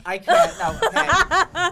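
high, helpless laughter; laughing. Real, not acted. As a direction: crack up through the words. Cracking up at the recording.

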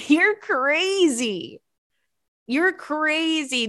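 high, drawn-out exclamation; playful disbelief. Real, not acted. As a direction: stretch it out in giddy, exaggerated disbelief. Mock outrage over loving corn dogs.

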